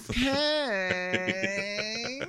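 high, drawn-out call; a girl calling for the puking guest; mock sing-song call. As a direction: call his name up high.

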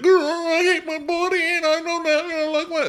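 very high, loud hammed-up crying; imitating a tearful meltdown; mock distraught wail. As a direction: wail it in an exaggerated squeal. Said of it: "taking it so deeply and darkly".

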